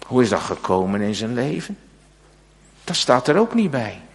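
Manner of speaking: normal talking voice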